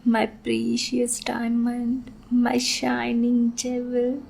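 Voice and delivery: slow, drawn-out pet names; slow savoring. Acted. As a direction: savor each word possessively.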